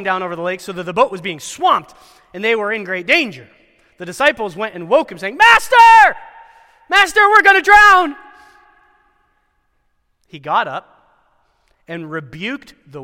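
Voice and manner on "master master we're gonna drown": high, loud terrified cry of drowning men; panicked shout. Acted. Disciples screaming at sleeping Jesus in storm, panicked shout. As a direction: yell for help over the storm, desperate.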